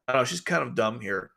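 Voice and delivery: ordinary talk, nothing marked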